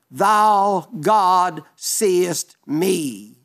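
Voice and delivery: drawn out slowly, each word weighed; reverent slow cry. Acted. Hagar crying out to God, slow and reverent. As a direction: let every word of the cry hang in awe.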